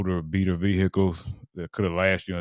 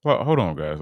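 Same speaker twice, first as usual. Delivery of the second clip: disbelief. Real, not acted. Host shocked by loan terms.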